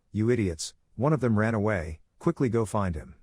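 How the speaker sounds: ordinary delivery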